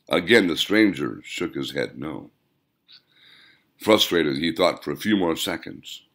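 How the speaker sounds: ordinary delivery, nothing marked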